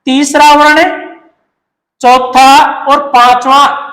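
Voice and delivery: तीसरा वर्ण, चौथा और पांचवा drawn out word by word; teacher stressing the key point; slow emphasis. Shown as a demonstration. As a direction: spell out third, fourth and fifth slowly. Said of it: "तो कहने का अर्थ है".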